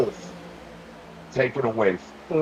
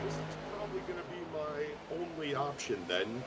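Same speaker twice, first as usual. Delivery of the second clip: distant, echoing mic; cave echo; 3 semitones higher, 14 dB quieter, faster than usual. Real, not acted. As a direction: voice from inside a cave. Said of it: "it sounds like you're talking into a cave".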